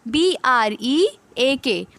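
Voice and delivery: slow letter-by-letter spelling; spelling out slowly. Shown as a demonstration. Teacher spelling a word for students, slow and deliberate. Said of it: "break की spelling है देखो".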